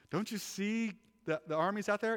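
high, insistent urging; excited prophet. Acted. Prophet urging his servant to see, high and insistent.

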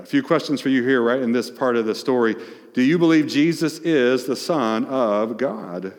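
ordinary talk

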